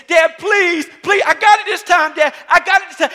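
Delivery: high, loud shouted begging; son pleading. Retold or imitated. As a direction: plead like a kid desperate for another go. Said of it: "And he's like".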